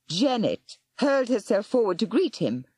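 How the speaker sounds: usual speaking voice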